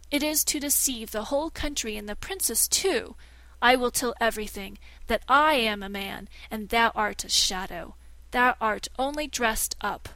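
a man insisting he is a man; indignant threat. Acted. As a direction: blurt out the truth in anger. Learned man threatens exposure.

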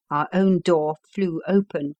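plain reading voice

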